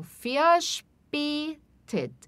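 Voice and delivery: verspätet with the V said as F, drawn out slowly; German V as F. Shown as a demonstration. Teacher to students, slowly sounding out verspätet. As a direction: start verspätet with a clear F. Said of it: "vai ter som de F".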